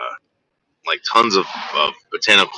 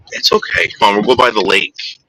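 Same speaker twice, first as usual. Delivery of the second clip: ordinary talk, nothing marked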